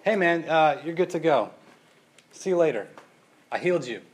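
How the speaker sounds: offhand, dismissive send-off; breezy casual Jesus. Pretend. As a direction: wave him off lightly. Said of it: "if Jesus would have just kind of said".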